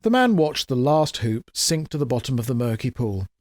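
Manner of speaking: plain reading voice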